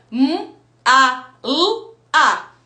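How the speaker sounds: M, A, L, A said one sound at a time; each sound of MALA set apart, letter by letter. Shown as a demonstration. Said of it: "uma bolinha pra cada som". (soletrando som por som)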